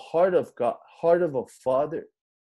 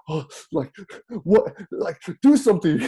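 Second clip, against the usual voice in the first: mock panic, rising louder and higher; freaking out; 4 semitones higher, 5 dB louder than usual. Panicky new dad to his calm wife, acted freak-out. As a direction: act out a flustered outburst. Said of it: "I'm just freaking out".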